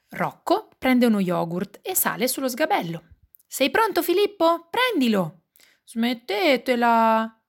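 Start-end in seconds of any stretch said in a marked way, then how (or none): 3.5-5.4 put-on playful child voice, pitched high; bambino che chiama il fratello. A narrator's reading. Child throws yogurt to brother. 5.8-7.4 drawn-out, louder cry of protest; little girl yelling at her brothers, stretched out; grido di protesta. A narrator's reading. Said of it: "grida Carlotta".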